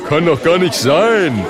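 disbelieving shout. Acted. A spectator rejecting the claim, loud incredulous shout. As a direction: yell it from the crowd. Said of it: "die Leute rufen".

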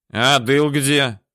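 put-on slow, slurred drunk question. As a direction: ask it like a drunk. Drunk Yasha asks loudly.

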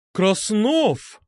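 high, drawn-out roar of a name. A narrator's reading. Medusa turns on Krasnov, roaring. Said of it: "взревела Медуза".

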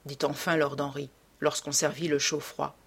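ordinary delivery, nothing marked

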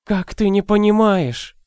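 put-on high, slow lamenting old woman; despairing old nurse; grieving old woman. A narrator's reading. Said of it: "сокрушалась добрая старуха". (сокрушённая старуха)

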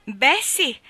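high, loud grudging concession; Mrs Glegg, grudging. Acted. Mrs Glegg grudgingly answers her sister, loud. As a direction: give in with bad grace. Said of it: "disse missus Glegg".

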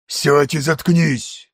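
slow rough brute command; growling brute. A narrator's reading. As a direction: bark it like a beast, slowly. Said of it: "бросил ещё один волк". (медленный рык грубияна)

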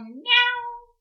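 high, loud meow. Acted. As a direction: meow loudly. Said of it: "громко сказал".

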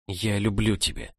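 breathy whisper against his lips. Acted. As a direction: whisper it, tender and close. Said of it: "прошептала ему в губы".

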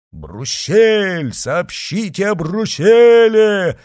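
loud, high shout with long-drawn names; shouting crowd. A narrator's reading. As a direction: yell up from the street like a crowd. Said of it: "кричала толпа". (крик толпы, громко и протяжно)

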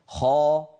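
خا pressed up to the palate, heavy and full; emphatic kha. Shown as a demonstration. A teacher demonstrating a properly emphatic خا. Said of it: "لأحدث تفخيما هكذا".

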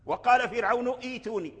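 فرعون إيتوني broken by a glottal ee before tuni; faulty sounded hamza. Retold or imitated. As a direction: keep a wrong hamza at the start of إيتوني. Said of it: "لحن هذا".

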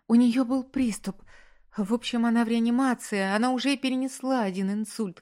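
high-pitched, agitated desperation; acted panicked plea, pitch held high; distraught pleading. A narrator's reading. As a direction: pour it out as if on the verge of sobbing. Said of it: "Девушка рыдала".

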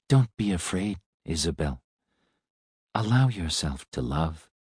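soft, reassuring robot voice; gentle. A narrator's reading. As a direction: reassure her quietly. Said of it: "His voice was gentle".

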